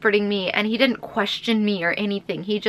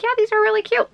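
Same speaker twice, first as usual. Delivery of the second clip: high gushing over the socks; 13 semitones higher, 4 dB louder, much faster than usual. Retold or imitated. Showing boyfriend the pink socks, high gushing. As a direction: squeal about how cute they are. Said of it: "And I'm like".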